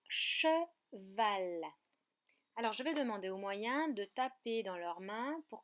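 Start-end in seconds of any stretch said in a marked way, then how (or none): 0.1-1.6 CHEVAL slowly split into che and val; syllable by syllable. Shown as a demonstration. Teacher presents a new word to children, slowly split into syllables. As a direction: draw out each half of "cheval", che... val. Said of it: "Il s'agit du mot".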